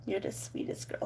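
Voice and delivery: soft unvoiced whisper to a pet. Real, not acted. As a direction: whisper sweetly to the dog. Whispers to dog.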